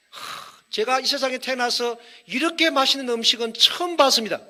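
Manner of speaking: hammed-up praise. Mock lavish praise of food.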